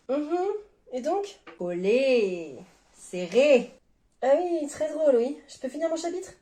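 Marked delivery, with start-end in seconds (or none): 1.6-3.7 slow, suggestive pun on a name; teasing wordplay. Acted. Partner teasing a reader, drawn-out wordplay. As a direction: draw out each word, leaving a gap between.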